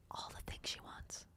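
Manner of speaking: hushed conspiratorial whisper, quick in places; breathless secret whisper with no voice at all. Pretend. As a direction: murmur it like a sly aside nobody should hear. Whispering mock advice to husband.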